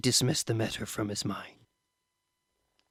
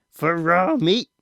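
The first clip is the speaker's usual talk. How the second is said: slow, high, louder disbelief; incredulous; 7 semitones higher, 7 dB louder, slower than usual. A narrator's reading. Father doubts son ate raw meat, incredulous. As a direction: drag it out in disbelief.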